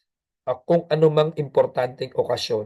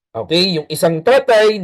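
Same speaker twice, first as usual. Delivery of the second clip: ordinary delivery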